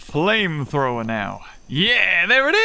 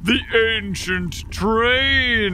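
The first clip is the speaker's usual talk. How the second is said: awed; 6 semitones higher, much slower than usual. Real, not acted. Awed discovery of the train.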